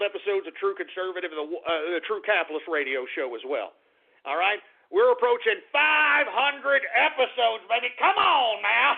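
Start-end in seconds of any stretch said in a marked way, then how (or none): 4.9-8.9 pumped-up and jubilant; excited boasting. Real, not acted. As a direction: crow proudly about the milestone. Boasting of the milestone again.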